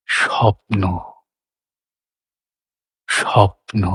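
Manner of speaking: স্বপ্ন said softly and dreamily, drawn out; dreamy feeling. Shown as a demonstration. Teacher voicing the feeling of a dream to students. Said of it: "স্বপ্নের অনুভব".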